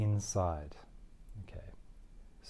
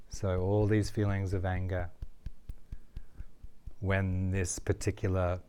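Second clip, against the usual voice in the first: tapping script. Acted. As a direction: ease them toward relaxing.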